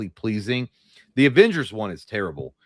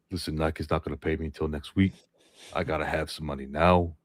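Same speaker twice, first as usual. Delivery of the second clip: lower, softer Shaq voice; a sleepy take on Shaq; Shaq impression; 7 semitones lower, 6 dB quieter than usual. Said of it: "I can't do it".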